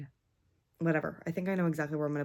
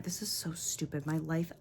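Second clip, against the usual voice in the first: usual speaking voice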